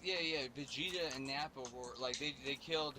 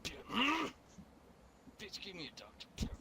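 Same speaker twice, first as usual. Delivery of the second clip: higher, then rushed demand; put-on demand; 5 semitones higher, faster than usual. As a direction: toy with her, then rattle the order. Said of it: "I was like".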